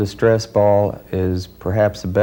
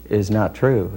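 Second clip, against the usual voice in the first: usual speaking voice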